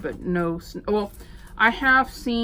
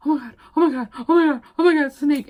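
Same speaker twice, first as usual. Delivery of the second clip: terrified; 6 semitones higher, 6 dB louder, much faster than usual. Retold or imitated. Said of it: "I was terrified".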